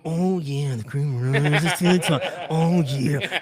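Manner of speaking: a light take on the wrestler's growling promo drawl; Macho Man Randy Savage. Retold or imitated. Friend's Macho Man impression retold in the studio, lightly voiced. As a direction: ham up a wrestling promo boast. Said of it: "He did a three-minute Macho Man".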